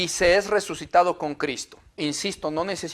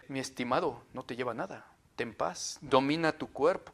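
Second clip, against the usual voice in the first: much softer and lower, calm guru tone; serene monk; 5 semitones lower, 13 dB quieter than usual. Retold or imitated. The monk calming his rushed old friend, hushed and low. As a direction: soothe the hurried friend like a guru.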